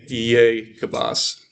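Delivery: "Jacobus" drawn out slowly, syllable by syllable; slow demo word. Shown as a demonstration. Teacher answers a student's vowel question, saying Jacobus slowly. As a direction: stretch "Jacobus" out slowly so each syllable is heard. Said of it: "a half syllable, E A".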